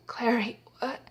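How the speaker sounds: hushed acted betrayal; stunned murmur. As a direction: imitate a stabbed man's faint disbelief. Repeats stabbed man's shock.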